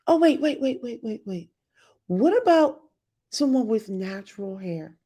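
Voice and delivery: normal talking voice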